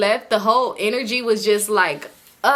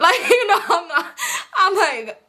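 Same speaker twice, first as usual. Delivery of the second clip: breaks into high laughter mid-sentence; laughing; 9 semitones higher than usual. Real, not acted. Laughing at her own stubbornness.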